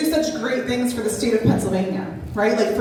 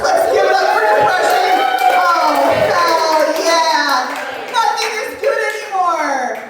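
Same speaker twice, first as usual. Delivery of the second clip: mock cheer; 7 semitones higher than usual. Ironic cheer for depression.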